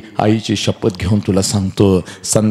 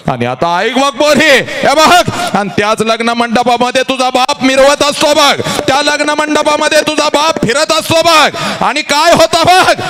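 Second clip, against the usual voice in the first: high, louder sung-out preaching; 1 full-force preaching; 3 dB louder than usual. Acted. Preacher building to the father's part, high and loud. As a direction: drive it like a kirtan peak.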